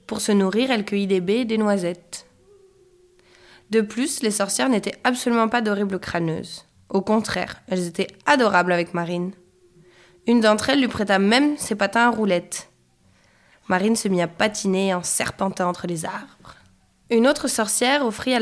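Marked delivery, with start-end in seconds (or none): none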